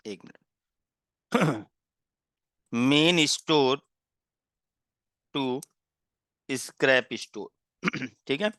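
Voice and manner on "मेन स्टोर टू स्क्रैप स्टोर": slow dictation. Shown as a demonstration. Teacher dictating a heading for students to copy, slow and deliberate. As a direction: dictate it word by word so students can write.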